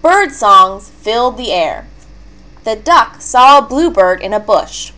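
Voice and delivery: usual speaking voice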